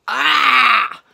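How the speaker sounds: very loud, high scream; full release scream. Retold or imitated. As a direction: let it all out in one loud yell. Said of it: "I could have screamed like that".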